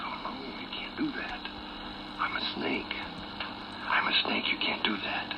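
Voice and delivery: very soft, whispered protest; hushed, feeble refusal in a fable; weak injured snake. Acted. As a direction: breathe it out like a wounded snake. Hurt snake speaks in told fable.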